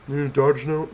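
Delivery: high, loud mock boss; hammed-up demanding boss. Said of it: "my boss probably have a freaking fit".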